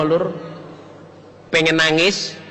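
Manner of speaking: ordinary talk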